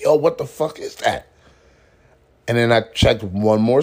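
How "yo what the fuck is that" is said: higher, alarmed sniff-test question; baffled and grossed out; a touch higher, baffled; shocked disgust. Retold or imitated. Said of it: "I'm like".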